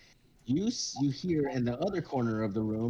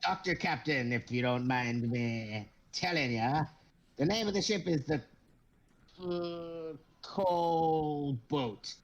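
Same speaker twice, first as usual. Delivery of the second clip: pompous kobold captain; 3 semitones higher than usual. Acted. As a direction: puff up like a tiny captain in a big hat. Kobold explains ship name.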